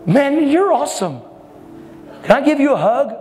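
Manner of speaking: high-pitched imitation of an impressed guy; awestruck gushing. Impressed man asking a stranger for a hug, imitated gushing.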